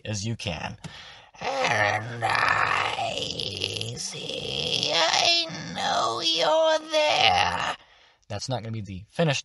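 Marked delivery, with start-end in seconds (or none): 1.4-7.8 slow, squeezed crone cry rising to a high shriek; creepy strangled hag. Shown as a demonstration. Teacher trying out the strangled hag voice on players. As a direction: creep them out with a choked wail. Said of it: "You're trying to strangle your voice with this sound".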